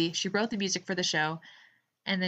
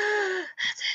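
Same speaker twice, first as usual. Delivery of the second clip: gasp of recognition; 11 semitones higher than usual. Retold or imitated. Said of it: "and I was like".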